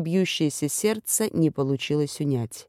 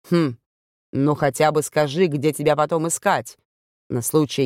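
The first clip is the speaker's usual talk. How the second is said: ordinary delivery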